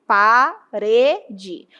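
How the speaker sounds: "Parede" broken up, "re" as the strong syllable; stress on RE. Shown as a demonstration. Teacher letting students find the strong syllable, slowly split "Parede". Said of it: "Com certeza você vai dizer o re".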